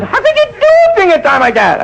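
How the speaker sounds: shocked nurse in high falsetto; nurse's shocked squeak. Acted. Nurse gasps at the patient in high falsetto. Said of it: "She goes".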